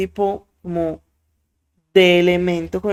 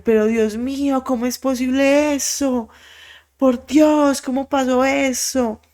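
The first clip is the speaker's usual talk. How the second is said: high, anguished cry of disbelief; a grandmother's high, horrified outcry over the dead baby; 7 semitones higher than usual. Retold or imitated. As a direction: gasp it out as if seeing something unbearable.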